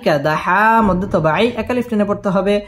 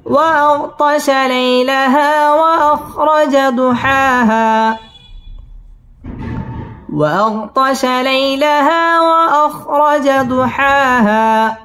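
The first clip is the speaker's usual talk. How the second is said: slow, melodic tajweed chant; Quran recitation; 3 semitones higher, slower than usual. Shown as a demonstration. Teacher chanting the verse for the student, slow and melodic. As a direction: draw the vowels out in a sung recitation. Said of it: "teacher models the verse".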